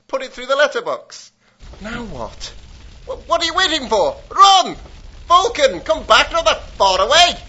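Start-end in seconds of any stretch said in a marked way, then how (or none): 3.1-4.8 urgent shouting. Acted. Urging to flee after bomb. 5.3-7.4 calling out. Acted. Calling back running henchman.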